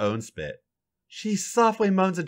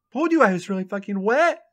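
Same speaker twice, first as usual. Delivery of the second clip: smiling; 7 semitones higher than usual. A narrator's reading. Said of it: "says with a smile".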